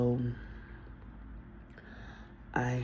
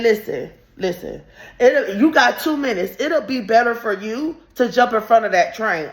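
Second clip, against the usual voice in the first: raised, loud menacing warning; mock threat; 6 semitones higher, 5 dB louder than usual. Threatening the girl with two minutes, loud. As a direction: lay down the ultimatum.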